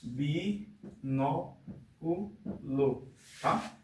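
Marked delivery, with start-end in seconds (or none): 0.0-1.6 "Binóculo" very slowly, split bi-nó-cu-lo with pauses; syllable by syllable. Shown as a demonstration. Teacher counting syllables for a pupil, "Binóculo" syllable by syllable. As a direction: softly break "Binóculo" into four separate syllables. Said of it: "Quatro sílabas".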